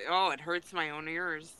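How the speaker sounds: usual speaking voice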